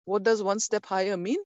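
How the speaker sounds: ordinary talk, nothing marked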